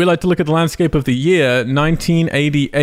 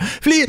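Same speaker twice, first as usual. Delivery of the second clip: imitated noise. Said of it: "he just went".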